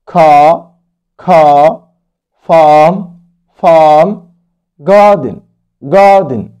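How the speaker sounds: star, park, car, farm, garden all said with a long open a and no R; non-rhotic ar words. Shown as a demonstration. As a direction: drop the R in every ar word, British style. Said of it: "وينفع ما ننطقش ال R".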